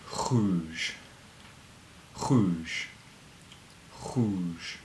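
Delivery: Rouge with a throaty French uvular r. Shown as a demonstration. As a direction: roll the r in Rouge at the back of the throat. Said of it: "say the French R sound".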